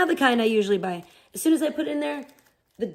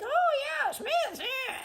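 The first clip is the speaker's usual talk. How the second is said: much higher and softer, airy put-on voice; imitating mom; 6 dB quieter, much slower than usual. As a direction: do mom's breezy high little approval. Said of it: "my mom's always like".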